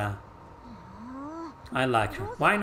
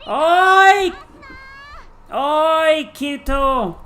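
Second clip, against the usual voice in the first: shrill shout of oi, high and loud; loud call; 12 semitones higher, 9 dB louder, much slower than usual. Acted. Game girl calls out to Kirito. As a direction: yell it out to get attention.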